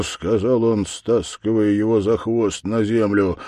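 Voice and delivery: plain reading voice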